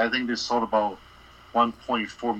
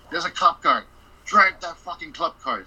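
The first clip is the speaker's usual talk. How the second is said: higher and louder, an impatient command; loud order; 5 semitones higher, 5 dB louder than usual. Retold or imitated. Boss ordering the crew to drive the club car, loud.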